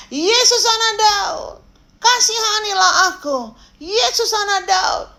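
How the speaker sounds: high, drawn-out pleading call; crying out. Acted. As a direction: call out slowly from afar, pitched high. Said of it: "berseru-seru dia". (berseru memohon dengan nada tinggi)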